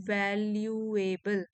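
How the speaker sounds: Valuable drawn out in slow, separate syllables. Shown as a demonstration. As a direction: stretch each syllable of valuable for a learner. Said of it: "इसमें आप बोल के देखोगे". (Valuable को एक-एक syllable तोड़कर धीरे बोलना)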